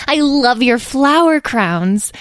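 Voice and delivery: high, drawn-out adoration; eager little character voice; gushing. A narrator's reading. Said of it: "gushed Edwin".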